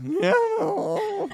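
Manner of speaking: high squealed no. Retold or imitated. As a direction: squeal it in disbelief. Reacting to being followed back.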